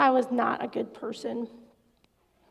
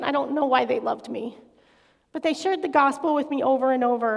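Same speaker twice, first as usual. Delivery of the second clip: ordinary delivery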